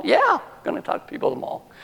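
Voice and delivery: very high, quick comeback; insistent confirmation. Retold or imitated. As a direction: squeak out the confirmation at a fast clip. Acted insistent answer to doubters.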